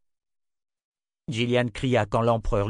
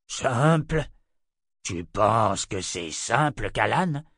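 slow, indignant tyrant voice; menacing disbelief, deliberate pacing; incredulous emperor; much slower than usual. Acted. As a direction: throw the word back, drawn out. Emperor incredulously throws word back.

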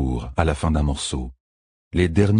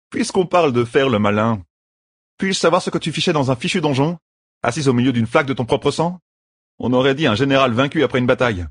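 higher-pitched, lightly voiced reproach; indignant rogue; 7 semitones higher than usual. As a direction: demand an explanation, exasperated. Voices Matt reproaching Elaine.

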